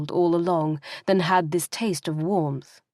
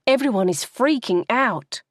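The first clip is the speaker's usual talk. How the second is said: happy; 5 semitones higher than usual. Acted. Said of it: "Olivia said happily".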